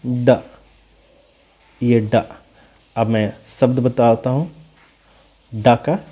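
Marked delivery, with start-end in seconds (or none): 0.1-2.2 "ड" as a short, isolated "duh"; letter's sound. Shown as a demonstration. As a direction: repeat "ड" as a separate sound. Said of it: "क्या आता है?". ("ड" अलग से दोहराते हुए)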